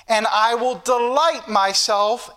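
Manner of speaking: slow, measured declamation of a verse; emphatic, drawn-out pulpit reading; scripture reading. Real, not acted. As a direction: read the verse out slowly and deliberately. Reads scripture to congregation.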